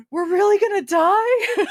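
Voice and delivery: gasp, then high, loud comic wail; hammed-up terror breaking into laughter; mock panic. Patients told they are landing at Walter Reed, mock panic.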